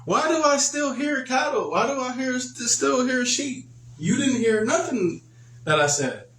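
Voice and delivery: high, indignant prophet rebuking Saul; Samuel rebuking. Retold or imitated. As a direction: call out the lie, pitched high. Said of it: "And he said".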